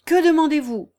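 shouted, high and loud; loud demand. Acted. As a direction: call through the door. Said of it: "ajouta-t-il à haute voix".

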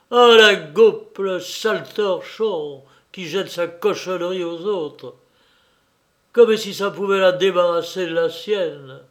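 furious scolding, peaking in a sharp yell; exasperated. A narrator's reading. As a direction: lash out at him in exasperation. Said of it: "prise d'une exaspération".

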